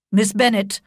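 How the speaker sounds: put-on a grand lady scolding the young woman; angry noblewoman. A narrator's reading. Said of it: "replied her ladyship angrily".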